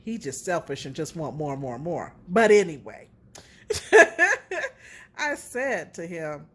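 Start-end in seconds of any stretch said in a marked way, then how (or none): 3.7-4.7 high, loud burst of laughter. Real, not acted. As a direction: break into laughter at the memory. Laughs at her own remark.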